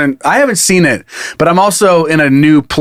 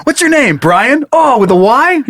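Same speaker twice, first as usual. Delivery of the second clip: mock chummy mail guy; 8 semitones higher, slower than usual. Mocking the mail guy's chat.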